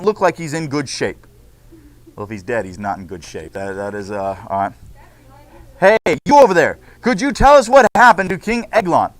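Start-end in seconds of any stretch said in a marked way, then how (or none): 5.8-9.1 louder reporter hail across the scene; calling out. Acted. Reporter calls out to Ehud across the crime scene. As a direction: grab his attention from a distance.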